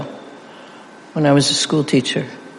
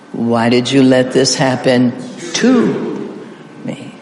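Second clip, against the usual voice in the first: level run-up, then punch "to"; stress on TO; slower than usual. Shown as a demonstration. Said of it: "we're going to emphasize".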